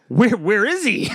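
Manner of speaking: high, loud squeaky creature voice; excited high gremlin squeak; Gizmo-style voice. Retold or imitated.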